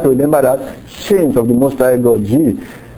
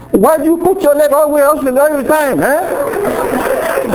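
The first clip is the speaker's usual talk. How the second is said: high-pitched, raised-voice demo of a complaint; louder scolding; 10 semitones higher, slower than usual. Shown as a demonstration. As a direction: scold in a much higher, raised voice. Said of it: "I can also say it louder".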